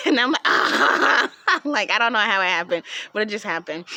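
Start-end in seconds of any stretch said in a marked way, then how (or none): 0.4-1.3 loud embarrassed ah. Retold or imitated. Mom already knew.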